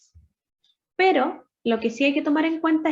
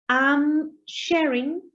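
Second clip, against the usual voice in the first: slow example; 2 semitones higher, much slower than usual. Shown as a demonstration. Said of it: "Por ejemplo".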